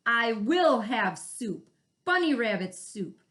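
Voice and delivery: angry varmint vowing bunny soup; furious threat. Acted.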